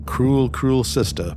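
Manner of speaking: plain reading voice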